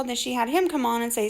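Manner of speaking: usual speaking voice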